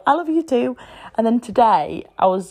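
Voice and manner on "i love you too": put-on higher, sing-song little boy answer; child's reply. Mum imitates her son finally answering her, in a lightly higher child voice. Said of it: "And eventually I'll go".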